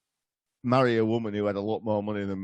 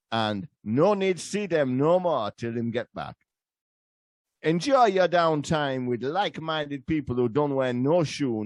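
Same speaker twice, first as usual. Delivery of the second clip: put-on Jamaican accent. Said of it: "this is basically a trial run for our new sponsorship".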